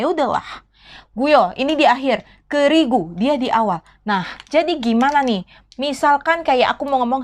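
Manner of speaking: ordinary delivery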